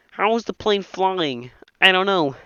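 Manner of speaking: ordinary talk, nothing marked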